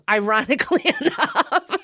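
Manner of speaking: laughing. Real, not acted. Podcaster promoting own YouTube channel, laughing at the irony. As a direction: giggle through the words.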